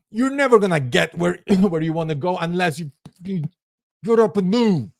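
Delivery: high, pushy scolding; nagging voice. Pretend. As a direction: scold yourself to get moving. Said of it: "another voice in your head that it's horrible".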